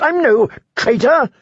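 high, pleading prisoner voice; desperate plea. A narrator's reading. Prisoner begging Sir Axel, high and desperate. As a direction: beg to be let go. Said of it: "the prisoner asked desperately".

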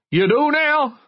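high-pitched, drawn-out jeer; high heckler's yell; mock yell. A narrator's reading. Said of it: "and someone yelled".

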